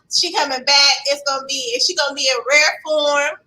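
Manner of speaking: ordinary delivery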